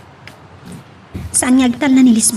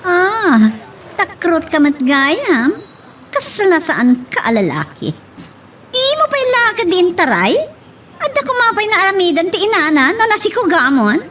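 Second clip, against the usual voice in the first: taunting; 7 semitones higher than usual. Acted. Lisbeth taunting Benji.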